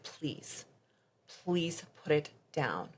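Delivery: hushed, trembling plea to put the knife down; quiet, frightened begging. Retold or imitated. I started screaming.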